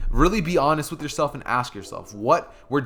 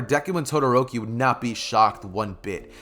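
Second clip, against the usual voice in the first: ordinary delivery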